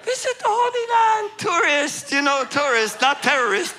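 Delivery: very high, loud comic pleading; hammed-up high-pitched insistence; mock panicked protest. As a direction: squeal it out like a cornered tourist. Panicked tourist excuse replayed for laughs.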